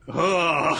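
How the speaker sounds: loud, high ugh of disgust; disgusted groan. Real, not acted. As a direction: groan in real revulsion. Reacting to evil scene.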